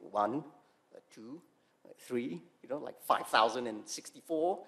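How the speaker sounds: low, soft tallying; hushed, low running tally; counting under the breath. Acted. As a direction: count them off quietly, one by one. Imagines God counting each tossing.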